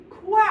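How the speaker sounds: "quoi" popped out high and loud as a rising question; prompting question. Shown as a demonstration. Teacher quizzes class on the direct object, punchy high "quoi". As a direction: hit "quoi" to prompt the answer. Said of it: "tu mets".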